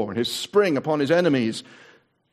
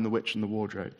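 ordinary delivery

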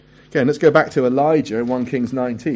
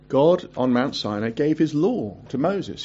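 ordinary talk, nothing marked

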